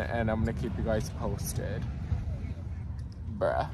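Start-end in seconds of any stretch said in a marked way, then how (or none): none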